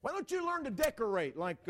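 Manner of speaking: loud, high nagging complaint; mock exasperated criticism; critical spouse. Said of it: "we start critiquing one another".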